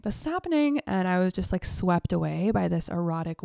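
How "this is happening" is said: excited. Retold or imitated. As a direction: burst out with thrilled excitement. Building excitement, repeated phrase.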